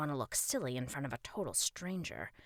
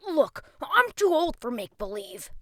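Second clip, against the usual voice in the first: put-on loud, high protesting boy; doubtful boy; 11 semitones higher, 12 dB louder than usual. Boy rebuffs the strange king, doubtful protest. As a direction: push back like an unconvinced kid.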